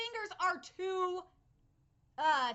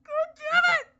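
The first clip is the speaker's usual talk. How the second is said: much higher, very loud outburst; anguished shout; 11 semitones higher, 15 dB louder than usual. Real, not acted. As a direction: cry out in grief. Overcome by sad scene.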